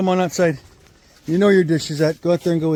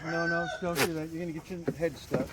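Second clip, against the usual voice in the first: hushed warning to a goat; soft scolding; 13 dB quieter than usual. Real, not acted. Gently scolding goat, quiet.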